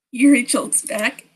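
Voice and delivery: normal talking voice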